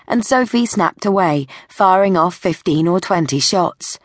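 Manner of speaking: usual speaking voice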